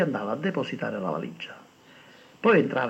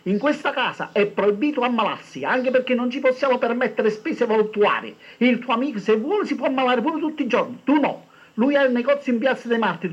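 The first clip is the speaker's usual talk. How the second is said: mother shouting; 9 semitones higher than usual. A narrator's reading. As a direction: keep yelling, laying down the law. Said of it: "gli gridò la madre dalla cucina".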